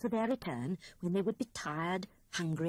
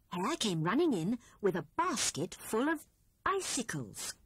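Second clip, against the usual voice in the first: ordinary delivery, nothing marked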